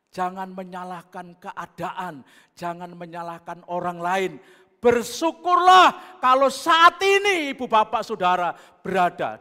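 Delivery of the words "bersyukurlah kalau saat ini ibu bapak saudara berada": loud, high exhortation; preaching at full force. Acted. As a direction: call the congregation to thanks. (seruan khotbah yang lantang)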